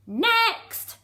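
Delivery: high, loud barked call; shout. Acted. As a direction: yell for the next one. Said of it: "Fran shouted".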